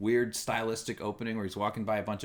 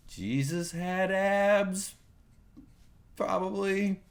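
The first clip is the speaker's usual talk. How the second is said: higher and very slow proclamation; slow solemn pope line; 7 semitones higher, much slower than usual. Pretend. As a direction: proclaim it like holy scripture. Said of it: "I am now the Pope".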